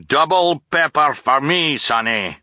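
high, loud demand; greedy captain's cry. A narrator's reading. Captain demanding pepper from Sunny, loud and hearty. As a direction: call for pepper like a blustering captain. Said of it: "Captain Widdershins cried".

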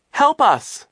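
high, loud cry for help; panicked high shout; desperate plea. A narrator's reading. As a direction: scream out for rescue. Isadora pleads while being abducted.

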